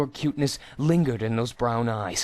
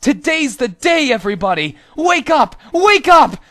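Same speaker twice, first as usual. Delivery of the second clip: much higher, very loud wake-up yell; comic rallying shout; 12 semitones higher, 12 dB louder than usual. Acted. His balls shout to everyone that the day has come, booming wake-up yell. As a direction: rouse the troops like a drill sergeant at dawn.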